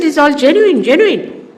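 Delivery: loud, high hawker's cry of "genuine"; mock shop vendor. Shopkeepers at Rome exhibition boast punk goods, loud and high. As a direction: sell it like a proud vendor. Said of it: "And they said".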